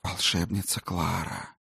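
faint, airy ghost whisper; ghostly whisper. Acted. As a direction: whisper like wind in dry grass. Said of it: "Едва слышный шёпот".

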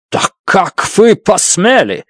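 lightly voiced angry outburst; flaring up. A narrator's reading. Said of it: "вспылил Фокт".

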